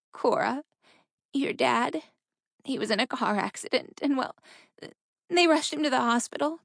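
high, halting, tearful voice; breaking down. A narrator's reading. Mother telling her daughter of a death, breaking down. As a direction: stop and start, pitch climbing as the grief takes over. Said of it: "her mother broke down again".

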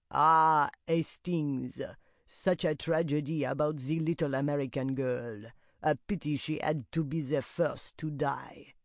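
lightly voiced Belgian detective; smug detective voice with a Belgian accent; Hercule Poirot. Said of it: "I could just see him twirling his mustache, saying".

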